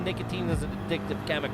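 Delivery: rushed fine print; fast disclaimer. Acted. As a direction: rattle off the warning.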